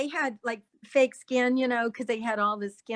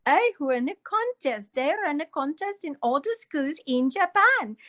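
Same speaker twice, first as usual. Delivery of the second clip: higher, acted Japanese accent; 4 semitones higher than usual. Shown as a demonstration. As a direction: perform a movie line in a Japanese accent. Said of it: "I had to do a Japanese accent".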